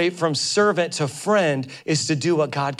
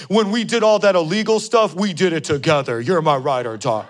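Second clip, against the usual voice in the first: partner in crime; 4 dB louder than usual. Retold or imitated. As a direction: ham up the old crime partner bragging. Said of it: "You know what ride or die means?".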